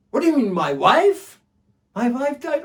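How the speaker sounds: high, loud distraught cry. Acted. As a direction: cry out in shock and dread. Bedou in despair.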